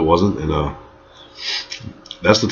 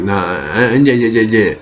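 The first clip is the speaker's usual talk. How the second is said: mock drunken babble, words repeating; drunk woman; 3 semitones higher than usual. Drunk woman insisting to the storyteller, mock drunken babble. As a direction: imitate a drunk stumbling over her words. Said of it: "I'm just drunk".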